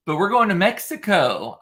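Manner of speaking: excited announcement. Real, not acted. Telling viewers about tomorrow's trip to Mexico, excited. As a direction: announce it with a gleeful lift.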